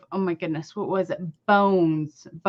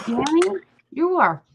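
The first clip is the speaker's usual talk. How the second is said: higher, slow and weighty accusation; angry call-out; 5 semitones higher, 4 dB louder, slower than usual. Real, not acted. Calling out Danny on stream as a sociopath, angry. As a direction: lay each word on him hard.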